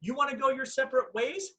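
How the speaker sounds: high, breezy fake nonchalance; mock indifference. Replaying the old breakup to the ex, feigning indifference. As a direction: act as if the breakup is no problem, pitch lifted. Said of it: "Like before it was".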